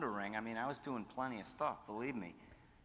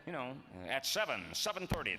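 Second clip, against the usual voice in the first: high, pompous DJ plug stretching the syllables; dumb disc jockey; 6 semitones higher than usual. Retold or imitated. Sending up old DJ colleagues, pompous stretched plug. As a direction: swing every syllable like a big DJ. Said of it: "big dumb disc jockeys".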